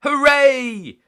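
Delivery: high loud hooray; cheer. A narrator's reading. Peppa cheering at the playground, high loud. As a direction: cheer with joy. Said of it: "cheered Peppa".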